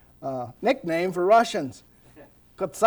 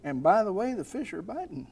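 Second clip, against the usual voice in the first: softer sly murmur, then a laugh; hushed devilish aside about fishing; sly tempting aside; 3 semitones higher, 7 dB quieter than usual. Acted. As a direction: tempt them quietly with fishing. Voicing distracting thoughts, laughing.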